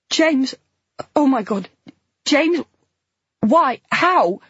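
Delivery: high, loud, desperate; distraught. A narrator's reading. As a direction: plead in disbelief. Horrified recognition.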